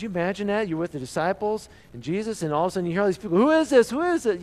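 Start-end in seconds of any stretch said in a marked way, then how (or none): 3.4-4.4 high, loud uproar of the crowd; crowd shouting. Acted. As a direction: shout it out like the whole city asking.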